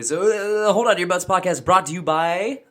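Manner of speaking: loud, high announcer intro. Acted. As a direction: boom it out like a show host. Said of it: "Let's try it one more time".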